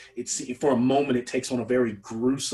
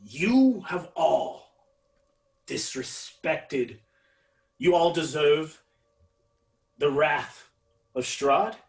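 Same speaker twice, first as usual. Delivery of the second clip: furious proclamation; 4 semitones higher, 4 dB louder than usual. Acted. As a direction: bellow the wrath of Strahd at them. Villain threatens the party.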